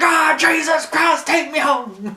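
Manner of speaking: high-pitched wailing yell of a man being beaten; hammed-up cry for rescue, loud and stretched; Paul Heyman. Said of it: "And Paul's like".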